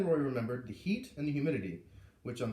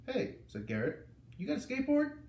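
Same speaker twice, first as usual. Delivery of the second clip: put-on much higher, casual boy; high kid voice; 11 semitones higher than usual. A narrator's reading. Garrett asking Roy at lunch, high boyish voice. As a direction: pitch way up like an eager schoolboy.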